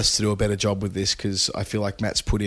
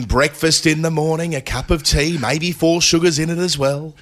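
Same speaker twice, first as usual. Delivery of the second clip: higher-pitched commentator imitation; Jeremy Coney; 6 semitones higher than usual. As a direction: chime in with an animated, lilting cricket pundit's voice. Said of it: "your best Jeremy Coney imitation".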